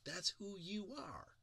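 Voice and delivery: 1 friends reassuring. Retold or imitated. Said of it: "your friends go".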